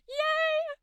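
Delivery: high, bright little cheer; excited cheer. Real, not acted. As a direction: celebrate the moment. Cheering at end of video.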